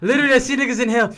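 fast, loud and laughing. Real, not acted. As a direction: blurt it out while laughing. Laughing at a funny memory.

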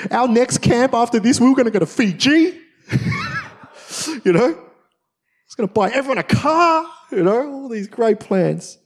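high, loud, laughing through big dreams; mock-grand, laughing at his old plans; excited laughing boast.

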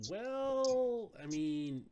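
high, loud, drawn-out "well"; mock hedging. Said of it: "that is in me would say that".

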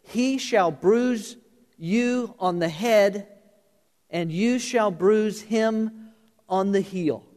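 very slow, deliberate reading; solemn, slowed-down scripture. Shown as a demonstration. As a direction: weigh each word of the verse. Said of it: "Watch this".

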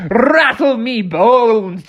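high, drawn-out rattly catchphrase; skeleton character. Acted. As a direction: play a gleeful dancing skeleton.

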